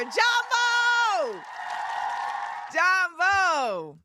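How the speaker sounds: high, long-held joyful call; excited shouted greeting. Real, not acted. Streamer greeting a viewer in Kenya, excited shout. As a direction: call it out big, stretching the last syllable.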